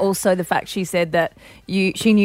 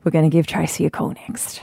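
ordinary talk

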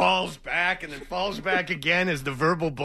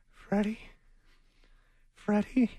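higher, tentative mom calling a name; lightly put-on higher mother's voice; imitating mom; 5 semitones higher, 3 dB quieter than usual. Mom calls downstairs to her son, imitated mother's voice. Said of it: "my mom will come downstairs and she'll go".

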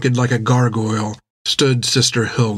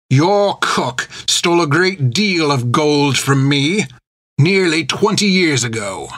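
put-on pirate captain taunting the old nun; menacing pirate; 5 semitones higher than usual. A narrator's reading. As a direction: savour every insult like a cruel captain. Creech voiced in the story.

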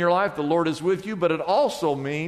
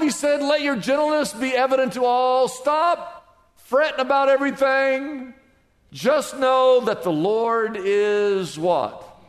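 raised, slow, emphatic preaching voice; 5 semitones higher, slower than usual. Acted. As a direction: proclaim it to the whole church, drawn out and high. Pastor preaching scripture with force.

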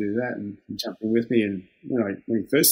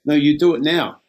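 louder, firm retold order, same as before; firm insistence; 4 semitones higher, 6 dB louder than usual. Trades assistant repeating his order to young worker, firm louder insistence. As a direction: repeat the demand, flat and final.

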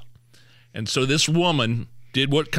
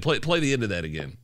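normal talking voice

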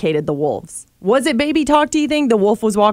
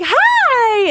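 excited; 14 semitones higher than usual. Retold or imitated. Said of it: "I'm so excited".

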